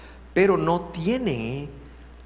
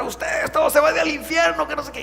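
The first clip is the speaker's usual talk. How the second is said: mock yell; 12 semitones higher, 4 dB louder than usual. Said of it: "se puso a gritar".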